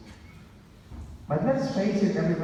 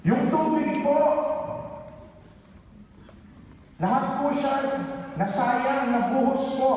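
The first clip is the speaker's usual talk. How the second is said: put-on much higher, anxious young caller; seminarian's voice; 11 semitones higher than usual. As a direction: voice the worried seminarian on the phone. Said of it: "Sabi sa akin".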